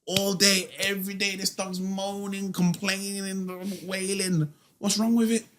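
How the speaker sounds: high, exasperated mock neighbour; fed-up neighbour. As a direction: squeak out the complaint like a neighbour at the end of his rope. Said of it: "and he would be like".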